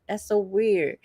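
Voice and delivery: ordinary talk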